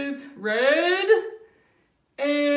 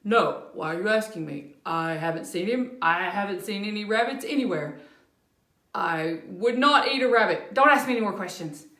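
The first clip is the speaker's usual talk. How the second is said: low, fast, defensive rattle; guilty fast denial; 5 semitones lower, faster than usual. Acted. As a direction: rush it out so nobody asks more. Bear guilty, denying rabbit.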